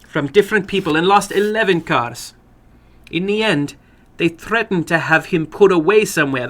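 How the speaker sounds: put-on exasperated older woman. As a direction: tell it with weary outrage. Woman voiced in story.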